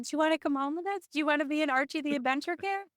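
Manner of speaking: put-on high, quick baby talk to a kitten; sweet-talking a kitten she wants to adopt; cooing pet talk. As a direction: coo at the kitten, voice lifted high and fast.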